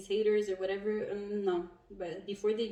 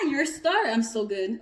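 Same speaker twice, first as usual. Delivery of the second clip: excited shout; 3 semitones higher, 9 dB louder than usual. Real, not acted. Reader shouting with delight at her own reading. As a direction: shout it out with joy.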